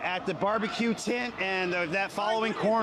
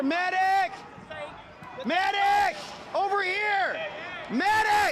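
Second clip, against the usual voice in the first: man down shout; 11 semitones higher, slower than usual. Real, not acted. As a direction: scream for a medic across the crowd. Calling for medic.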